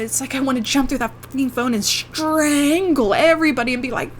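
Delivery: normal talking voice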